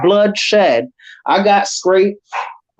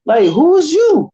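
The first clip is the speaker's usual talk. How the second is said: mock confronting yell at a stranger, pitched way up; confronting them; 13 semitones higher than usual. Confronting the drunk guy who insulted his wife, in an angry high yell. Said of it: "we was like".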